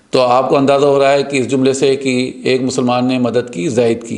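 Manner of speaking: ordinary delivery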